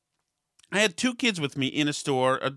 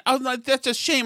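tut-tutting; 9 semitones higher than usual. Retold or imitated. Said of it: "and she's saying".

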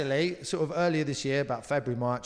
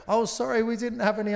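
mock apologetic, higher; imitating a sheepish marketing team, higher; 8 semitones higher, 4 dB louder than usual. Mocking the marketing team's apology to customers, higher. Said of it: "sort of going".

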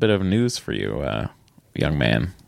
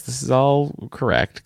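ordinary talk

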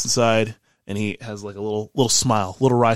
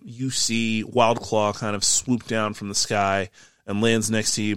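ordinary delivery

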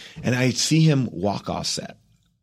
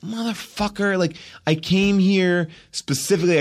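higher-pitched, contained indignation; retold inner anger; 7 semitones higher than usual. Recast actor thinks of what he gave up, retold anger. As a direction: fume inwardly without raising the volume. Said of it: "immediately angry because I'm like".

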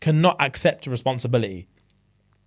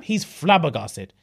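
ordinary talk, nothing marked

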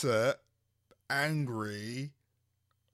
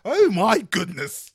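loud, high, drawn-out mock horror; over-the-top scandalised posh voice; mock posh shock; 9 semitones higher, 14 dB louder, much slower than usual. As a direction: exclaim slowly with laughing, hammed-up indignation. Playing up the teased posh persona.

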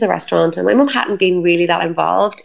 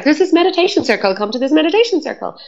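eager invite; 9 semitones higher, faster than usual. Retold or imitated. Lady inviting her.

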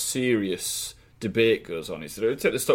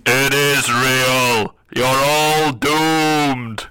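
slow ominous raspy drawl; 9 dB louder, much slower than usual. Pretend. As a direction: answer as an ominous god. Super coder answers ominously.

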